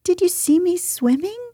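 high, eager little Roo; high-pitched childlike excitement; excited squeak. A narrator's reading. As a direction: squeak it eagerly, rising to a question. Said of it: "squeaked Roo excitedly".

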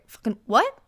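1 shocked outburst. Real, not acted. Shocked reaction to the message.

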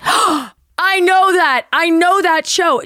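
high, drawn-out gasp of recognition; overjoyed. Retold or imitated. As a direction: squeal it, imitating her happy relief. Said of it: "she's so happy that she hasn't lost".

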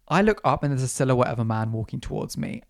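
ordinary talk

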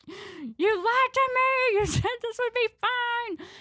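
very high, drawn-out, loud wail; hammed-up childish screech of betrayal; betrayed mock wail. Little sister accusing her sister, betrayed mock wail.

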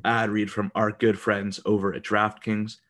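ordinary talk, nothing marked

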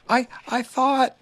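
high, slow, hesitant little voice; timid small animal; meek Piglet. A narrator's reading. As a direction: stammer it timidly. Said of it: "said Piglet meekly".

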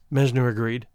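ordinary delivery, nothing marked

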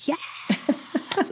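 hushed, voiceless imitation of a kid's roar; mock roar. Little kids answering Nana, mock roaring in an undertone. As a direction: roar it under your breath, soft and rough. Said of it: "they're, like, roaring at me".

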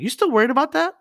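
high, loud mock disbelief; incredulous. Prankster asks the worried friend, acted incredulous. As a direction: shoot the question up high in amazement.

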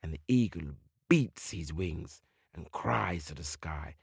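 hushed storyteller whisper. A narrator's reading. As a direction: breathe it out softly. Storyteller hushes before the cry.

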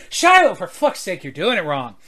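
loud and fed up, Shiloh doing it wrong; exasperated shout. Acted. Pirate maid yells at Shiloh for telling the story wrong. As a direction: yell at him for botching it. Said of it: "she socks him and she goes".

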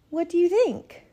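1 mother asking. A narrator's reading. Mother asks Oma about room.

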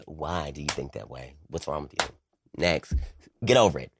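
a hint of a curt, dismissive friend; mock dismissive friend. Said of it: "I don't talk like that".

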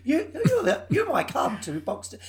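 mock customer. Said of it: "Like something from Downton Abbey".

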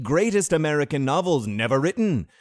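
loud, high mock olde English; hammy stage actor. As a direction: ham it up like a Shakespearean player. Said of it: "We were speaking dumb to remind you all about the olden times".

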